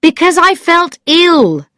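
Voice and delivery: abrupt high, loud burst; sudden. A narrator's reading. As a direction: blurt it out suddenly. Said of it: "Then he said suddenly".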